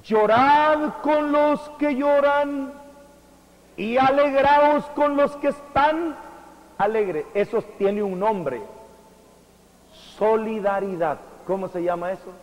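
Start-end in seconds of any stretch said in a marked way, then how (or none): 0.0-7.2 high, loud, slow declaration; preaching proclamation. Acted. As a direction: proclaim the verse to the congregation. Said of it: "les dice". (predicador proclamando, fuerte y lento)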